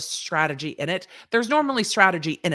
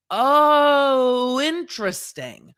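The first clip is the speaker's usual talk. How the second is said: long, high, loud "Oh", then a slow drop; mock intrigue; 8 semitones higher, 5 dB louder, much slower than usual. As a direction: stretch it out as a theatrical revelation. Mock reaction to plaintiffs' concession.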